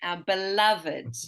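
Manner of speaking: slow be-LUV-id in "beloved"; three-syllable beloved. Shown as a demonstration. Teacher repeating for a student, slow three-syllable "beloved". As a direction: say "beloved" slowly with its -ed sounded. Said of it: "we actually pronounce that ed".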